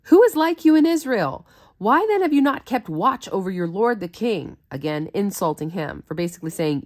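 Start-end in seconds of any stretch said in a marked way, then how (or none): 0.0-4.6 taunting question that settles back down; taunting call. Acted. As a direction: jeer at him across the hills. David taunting Abner from afar.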